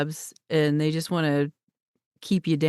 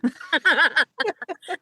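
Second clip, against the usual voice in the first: hearty laugh; 10 semitones higher than usual. Real, not acted. Host cracks up at the guest's joke, laughing high. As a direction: burst of high laughter, real and open.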